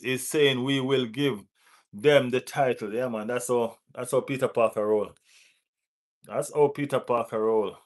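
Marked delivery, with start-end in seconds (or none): none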